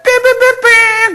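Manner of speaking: high, loud rooster crowing; imitated rooster crow. Rooster crows during Peter's denial, imitated loud crow. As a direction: crow loudly like a cock. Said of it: "달기 오는 거야".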